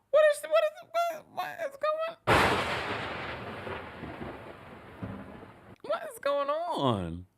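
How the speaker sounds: high, slow, drawn-out cry of disbelief; what is going on, really high and slow; stunned disbelief. Real, not acted. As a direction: shriek it out like you can't believe what you just heard. Stunned reaction to the show.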